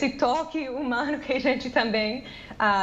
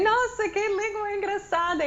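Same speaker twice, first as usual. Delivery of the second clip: high, wondering exclamation; high-pitched delighted surprise; amused surprise; 10 semitones higher than usual. Retold or imitated. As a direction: marvel at a funny new language. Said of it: "eu pensei".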